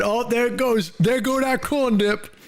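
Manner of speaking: high, silly voice, slowing down on the corn dip; lightly mock the friend's exclamation; goofy friend voice. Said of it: "that's when he said".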